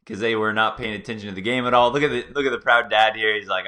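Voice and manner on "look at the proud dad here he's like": ordinary talk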